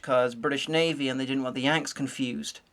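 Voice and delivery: usual speaking voice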